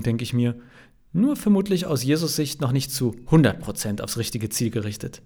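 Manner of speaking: ordinary delivery, nothing marked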